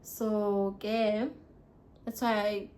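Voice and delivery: ordinary talk, nothing marked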